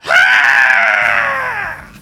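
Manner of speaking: high, loud startled cry; startled creature shriek. Acted. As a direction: shriek in alarm. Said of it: "she goes".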